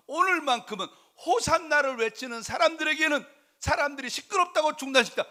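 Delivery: high, driving sermon delivery; full-force preaching. Acted. Preacher explaining why Jesus let the Hosanna shouts go on, impassioned. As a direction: raise the voice and preach with urgency.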